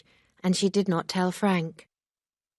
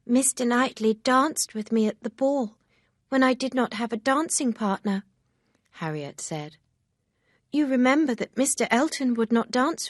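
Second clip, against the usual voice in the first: higher, lightly voiced young girl; a hint of an eager young friend; Harriet, lightly voiced, eager; 6 semitones higher, 4 dB louder than usual. A narrator's reading. Harriet speaks of Knightley.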